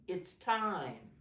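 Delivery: ordinary talk, nothing marked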